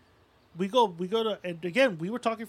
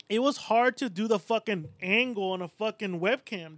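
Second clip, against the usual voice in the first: ordinary delivery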